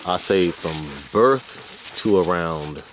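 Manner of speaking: ordinary delivery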